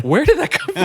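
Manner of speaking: high, loud incredulous outburst; mock-bewildered squawk; baffled disbelief. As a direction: act out a stunned movie viewer. Viewers' baffled reaction acted out.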